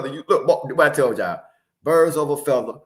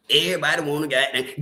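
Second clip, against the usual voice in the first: ordinary delivery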